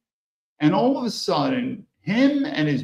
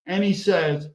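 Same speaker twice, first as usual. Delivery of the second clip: normal talking voice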